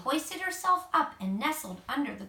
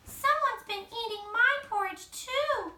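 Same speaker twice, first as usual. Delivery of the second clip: higher, sulking little cub; sulky Baby Bear; 11 semitones higher than usual. A narrator's reading. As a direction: pout over the lost porridge. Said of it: "sulked Baby Bear".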